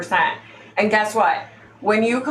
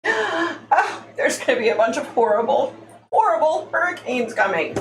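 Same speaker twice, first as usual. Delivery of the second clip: mock psychic prediction; 5 semitones higher than usual. Vlogger parodying psychic predictions, high swooning mock-psychic voice. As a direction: moan it out like a dramatic phony seer. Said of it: "So if I said to you".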